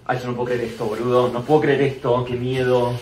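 ordinary delivery

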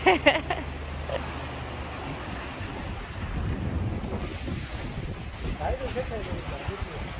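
long, breathy, airy laughter; breathless laugh. Real, not acted. Angler laughing to the viewers while fighting the fish, breathy giggle. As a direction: laugh under your breath while straining.

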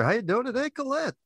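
host greeting his guest, high and excited. Real, not acted. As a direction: greet her with delight.

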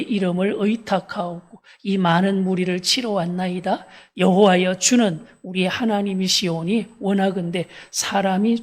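loud congregational reading of Asa's prayer; scripture read-aloud. Real, not acted.

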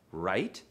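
"right" swooping up from a low start; rising question intonation. Shown as a demonstration. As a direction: demonstrate a question with a climbing "right". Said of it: "Goes up at the end".

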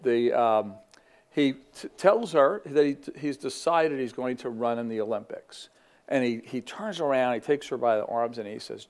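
normal talking voice

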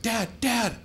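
put-on child piping up to tell Dad; seven-year-old daughter. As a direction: lightly imitate an earnest young child. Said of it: "But my seven-year-old daughter".

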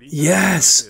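loud, high, excited yes; excited shout. Real, not acted. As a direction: cheer it out with delight. Cheering at a reveal.

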